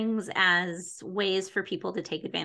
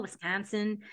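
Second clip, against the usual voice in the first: Wisconsin said the Wisconsin way, softer and through the nose; nasal Wisconsin accent; 6 dB quieter than usual. Shown as a demonstration. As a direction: local Wisconsin pronunciation of "Wisconsin", nasal and quiet. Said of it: "'cause it's very nasal".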